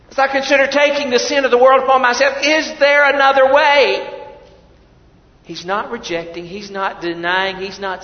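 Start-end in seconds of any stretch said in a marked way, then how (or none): 0.1-4.2 pleading question. Acted. Jesus asking his Father.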